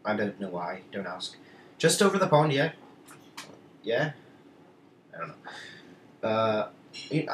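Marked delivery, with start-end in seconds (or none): 1.8-4.1 higher, louder mock Irish accent; terrible Irish accent. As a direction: slip into a bad put-on Irish accent. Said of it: "my terrible I-, Irish accent mode again".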